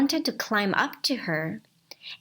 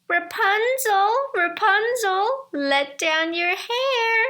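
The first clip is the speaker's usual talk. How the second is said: high, loud witch voice calling up the tower; 11 semitones higher, 5 dB louder than usual. Acted. Enchantress calling up to Rapunzel in the tower, high witchy call. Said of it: "he heard how she said".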